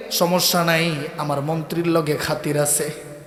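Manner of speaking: nonchalant. Acted. Youth's nonchalant reply to accusers.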